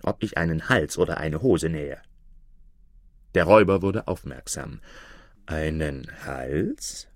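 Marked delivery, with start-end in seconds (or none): 5.4-7.1 low, slow, soft questioning; lightly voiced curious robber; sly robber asking. A narrator's reading. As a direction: probe the tailor's slip. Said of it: "fragte er".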